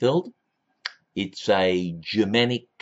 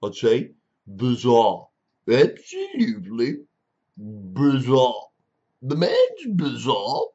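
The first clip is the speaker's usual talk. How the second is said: imitating Andrew Swift; slower than usual. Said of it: "If I was, the famous Andrew Swift".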